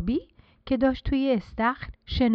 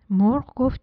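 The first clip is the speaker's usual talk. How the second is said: ordinary delivery, nothing marked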